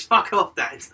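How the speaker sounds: high, loud, slow telling-off; mock shout. Son snapping at his dad in the game, mock loud yell. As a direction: bellow it at the dad. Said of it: "He goes".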